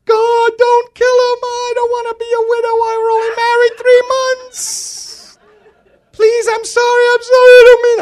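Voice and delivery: high, loud hammed-up crying plea; comic falsetto sobbing plea to God; mock hysterical wife. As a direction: wail it like a panicked young wife, played for laughs. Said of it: "started crying hysterically".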